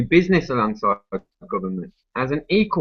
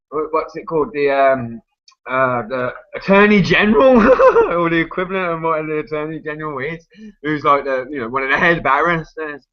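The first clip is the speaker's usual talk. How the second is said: ordinary talk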